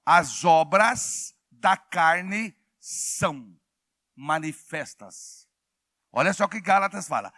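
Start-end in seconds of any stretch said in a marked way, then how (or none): 0.0-5.5 very slow, spaced-out words; deliberate, measured quoting of scripture; scripture reading. Shown as a demonstration. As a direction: read the verse word by word, with long pauses. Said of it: "A Bíblia diz em Gálatas assim".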